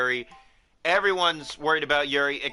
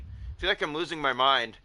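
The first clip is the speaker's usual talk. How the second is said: usual speaking voice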